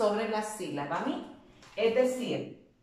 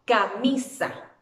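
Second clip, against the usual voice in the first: slow, louder camisa with MI punched; stress on MI; 5 dB louder, slower than usual. Shown as a demonstration. Teacher leading a class repeat, Camisa stressed on mi. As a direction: stretch and push the mi of Camisa. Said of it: "Repitámosla todo".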